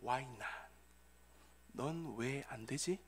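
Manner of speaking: very soft, low divine voice; reply slowly and quietly, pitched low; God's answer. Acted. God answering a grieving father, hushed low reply. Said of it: "하나님께서는 조 바이든에게 이렇게 대답하셨습니다".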